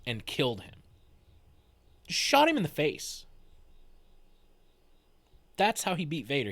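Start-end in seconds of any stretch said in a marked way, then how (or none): 2.1-6.5 amazed emphasis. Real, not acted. Disbelieving repeat of the punchline.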